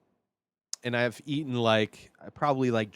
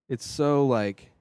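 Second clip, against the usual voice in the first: ordinary delivery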